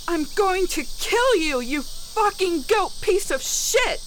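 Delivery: yelling at the top of lungs. A narrator's reading. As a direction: scream the threat with everything. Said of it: "I yelled at the top of my lungs".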